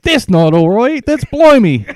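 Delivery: high, loud, badly faked British; hammed-up British indignation; mock British accent. Podcaster plays scandalized Brit, high loud mock British accent. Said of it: "That's my British accent".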